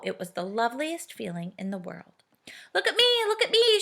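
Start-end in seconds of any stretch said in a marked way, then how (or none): 2.7-3.8 high, delighted calling; excited cry. A narrator's reading. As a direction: call down thrilled while flying. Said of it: "she cried".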